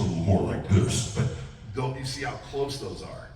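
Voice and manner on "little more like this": Hulk voice. Shown as a demonstration. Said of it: "want me to do the Hulk voice".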